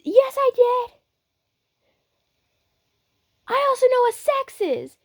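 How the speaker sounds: very high, loud insistence; defensive brash fighter. Acted. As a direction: insist loudly in a high-pitched voice. Brash character insists he knows.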